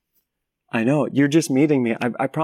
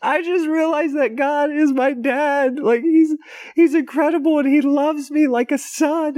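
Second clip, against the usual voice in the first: tearful crying; 12 semitones higher than usual. Retold or imitated. Retelling weeping on the plane.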